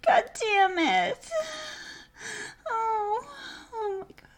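fading climax cries. Acted. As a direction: come down from the peak.

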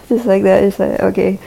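normal talking voice

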